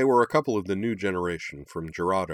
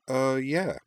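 hesitant; 7 semitones higher than usual. A narrator's reading. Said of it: "said Amy hesitantly".

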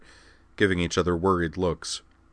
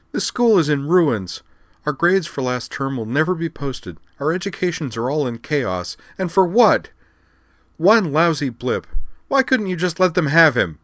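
angry rant; 9 semitones higher, 5 dB louder than usual. Acted. Liza blaming the group for ruin.

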